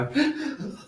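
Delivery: sharp, high startled gasp; demonstrated gasp. Shown as a demonstration. Teacher showing class how we tense up, startled gasp. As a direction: gasp quickly as if caught off guard. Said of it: "the tendency for us to go".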